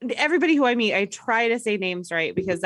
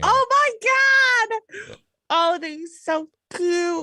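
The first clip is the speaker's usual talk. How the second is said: high, drawn-out delighted gush; melting with adoration, stretched out high; gushing squeal; 11 semitones higher, 4 dB louder, slower than usual. Real, not acted. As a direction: squeal over something adorably cute. Squealing over dog photos.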